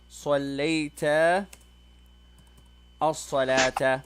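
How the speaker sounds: slow, drawn-out teacher model; slow model question. Shown as a demonstration. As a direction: spell it out slowly for the student. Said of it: "I'll say to you".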